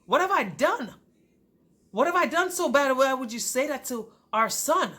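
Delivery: indignant pleading. Retold or imitated. Wife asking husband about remark to son, indignant. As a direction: demand an explanation, hurt and affronted.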